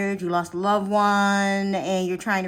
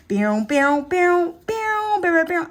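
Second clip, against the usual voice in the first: sound effect; 9 semitones higher, 4 dB louder, slower than usual. Pretend.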